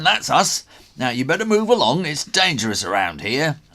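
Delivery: high, loud and fast workman warning; brisk workman. A narrator's reading. Workman tells Connor to move along, brisk and loud. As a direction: shoo the kid away, briskly. Workman warns boy away.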